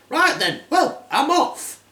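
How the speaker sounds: high cackling witch voice; hammed-up high old crone; old hag. Hag voice continues.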